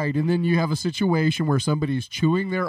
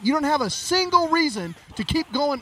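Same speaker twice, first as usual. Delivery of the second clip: higher, insistent retort; worked-up, pitched-up protest at normal volume; exasperated argument; 6 semitones higher than usual. Retold or imitated. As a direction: retell telling someone off, piling up the points. Acted quarrel with the stranger.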